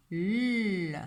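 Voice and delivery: "L" as a held lll, not the letter name; isolated sound. Shown as a demonstration. Teacher isolates the last sound, "L" held long. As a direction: hold the sound of "L". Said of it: "On entend".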